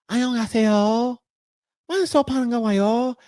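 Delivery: put-on girly Korean greeting. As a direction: switch to a sweet high Korean voice. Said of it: "it's all treble and it's all femininity".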